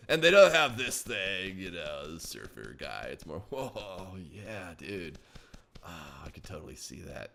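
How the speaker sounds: very low, soft, slow surfer voice; mock stoned surfer dude; surfer guy. Shown as a demonstration. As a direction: sink into a lazy surfer drawl. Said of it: "I can't get out of the surfer thing".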